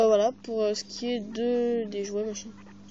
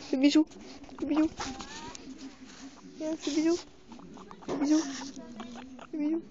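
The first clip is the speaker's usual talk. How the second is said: pet talk; 4 semitones higher, 5 dB quieter than usual. Real, not acted. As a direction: coo at a guinea pig. Coaxing the guinea pig to kiss.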